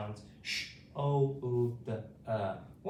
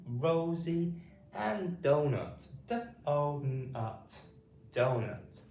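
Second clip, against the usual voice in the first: group repetition drill; 6 semitones higher than usual. Shown as a demonstration. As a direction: lead the class to repeat it back. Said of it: "Say it with me".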